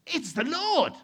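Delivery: high, loud exclamation of recognition; excited cry. Acted. John spotting Jesus from the boat, excited shout. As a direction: cry it out across the water.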